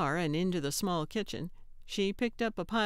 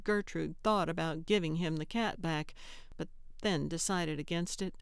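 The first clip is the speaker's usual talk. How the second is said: usual speaking voice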